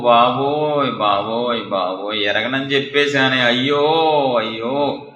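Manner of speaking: wail, then sag into helpless regret; moaning lament, acted. Peter regretting that he denied his Lord, acted moaning lament. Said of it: "మూలుగులతో గుండె పగిలేలాగా ఎందుకు ఏడుస్తున్నాడు".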